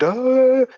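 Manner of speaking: high drawn-out wail; imitated wail. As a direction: mimic a roomful breaking into crying. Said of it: "And everybody went".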